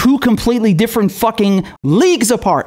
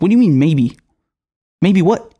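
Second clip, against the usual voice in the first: normal talking voice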